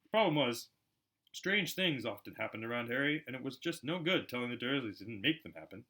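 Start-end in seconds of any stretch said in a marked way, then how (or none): none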